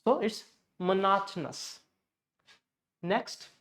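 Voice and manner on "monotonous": monotonous as muh-NAHT-nus; stressed NOT in monotonous. Shown as a demonstration. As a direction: reduce the outer O's of monotonous to schwa. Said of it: "it will convert into schwa".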